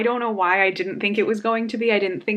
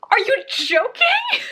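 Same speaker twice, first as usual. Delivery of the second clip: much higher, slower, stretched disbelief; disbelieving cry; 4 dB louder, slower than usual. Real, not acted. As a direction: cry it out in shock at the book. Shocked at book twist.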